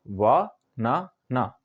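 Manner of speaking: "वा ना ना" in three slow separate beats; syllable by syllable. Shown as a demonstration. Teacher showing learners the three parts, "वा ना ना" said syllable by syllable. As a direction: say "वा ना ना" slowly, pausing after each syllable. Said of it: "तीन बार में बोल पाते हैं".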